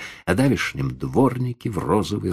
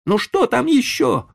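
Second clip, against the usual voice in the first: much higher, loud, rude; rude retort; 4 dB louder than usual. Acted. Boy answering the old man rudely. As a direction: answer back sullenly. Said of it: "грубо отозвался мальчик".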